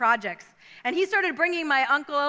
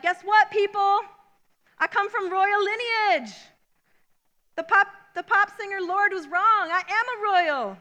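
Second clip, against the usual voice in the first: mock bragging; 6 semitones higher than usual. Churchgoer boasting royal ancestry on Facebook, mock smug. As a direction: brag like posting online.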